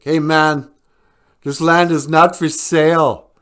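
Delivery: loud, high, hammed-up furious yell; mock angry shout. Val Kilmer yells at FBI in mock anger. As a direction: shout him off the land in mock rage. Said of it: "he's just mad, he's shaking".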